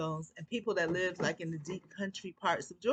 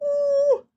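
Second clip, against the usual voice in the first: high, loud startled gasp; sharp gasp; 14 dB louder than usual. Real, not acted. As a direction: gasp as if spooked. Reacting to her own eerie story.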